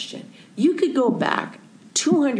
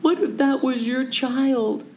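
higher, pleading and distressed; pleading upset; 7 semitones higher than usual. Retold or imitated. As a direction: beg him to feel for the old man. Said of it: "I'm really upset".

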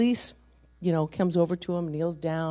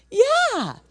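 high, loud mock child voice; lightly voiced small child; little boy's yes; 9 dB louder than usual. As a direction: imitate an eager little kid, pitched up. Voices grandson's answer.

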